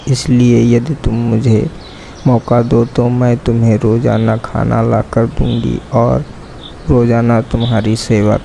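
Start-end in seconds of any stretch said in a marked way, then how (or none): none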